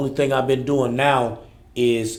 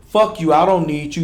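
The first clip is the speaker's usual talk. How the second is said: loud, higher shout at father; role-play shout; 4 semitones higher, 10 dB louder than usual. Acted. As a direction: yell it in the reenactment.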